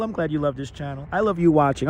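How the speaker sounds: ordinary delivery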